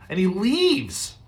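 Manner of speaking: drawn out, pitched high; high and slow. Shown as a demonstration. Preacher lingering on the exit, high and slow. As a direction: stretch it out, voice lifted. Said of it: "repeated for effect".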